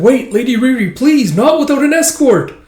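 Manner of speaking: high, loud call after her; frantic call. Acted. Guard calls after Lady Riri, high and loud. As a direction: shout to stop her running off.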